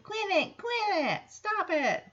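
high-pitched whiny mock protest; mock annoyed protest. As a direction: squeal it like a pestered kid, playfully. Acts out Jesse being sprayed.

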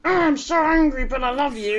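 high-pitched hammed-up zombie wife; mock angry wife. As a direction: overact torn between anger and love. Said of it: "his wife's all like".

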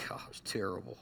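breathless whisper, almost to himself; a hushed, rueful whisper; whispered aside. Real, not acted. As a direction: whisper it as if to yourself. Whispered aside after a joke.

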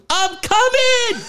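high, drawn-out excited shout; yelled into the phone. Retold or imitated. Prank caller's victim yelling into the phone, high excited shout. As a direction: belt it like you just won the prize. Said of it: "He just yelled in the phone".

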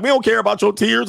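high, loud scolding parent; mock parent yell. Parents scolding crying kids, loud mock yell. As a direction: scold like an old-school parent. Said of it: "They be like".

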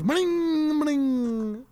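slow high sputtering car sound; stalling car noise. Retold or imitated. Stalled car, the driver mimics engine sputter.

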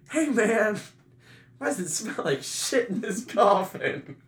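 high, loud imitation of a puzzled party host; mock outraged call. Fraternity brother asks about coffin smell, mock outraged. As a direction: act out calling someone over about a stink.

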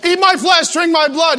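high, emphatic preacher declaration; mock proclamation. Preacher startling newcomers, mock proclamation. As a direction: pitch it up and proclaim it. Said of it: "if I was like".

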